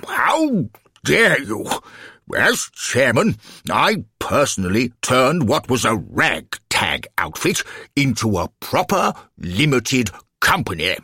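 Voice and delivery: pompous alderman swelling with outrage; indignant bluster. Acted. Affronted alderman blusters in self-defence.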